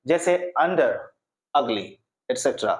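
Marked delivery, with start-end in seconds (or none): 0.6-1.8 under and ugly opened on a short "uh"; short "uh" vowel. Shown as a demonstration. Teacher giving short-sound examples, short "uh" vowel. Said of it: "अ का use करना चाहिए".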